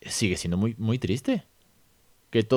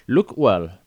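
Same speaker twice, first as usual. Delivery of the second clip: a teacher's louder coaxing prompt in English; mock teacher prompt; 8 dB louder than usual. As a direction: nudge the pupil to look again. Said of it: "por ejemplo, me lo estoy inventando".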